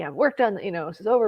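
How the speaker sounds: usual speaking voice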